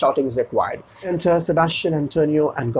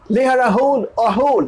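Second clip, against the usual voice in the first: shouted order; 9 semitones higher, 4 dB louder than usual. Acted. Boatswain shouts to mariners.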